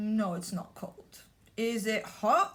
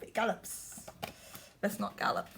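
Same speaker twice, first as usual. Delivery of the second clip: out of breath; 3 semitones lower, 7 dB quieter, faster than usual. Acted. Breathless after galloping.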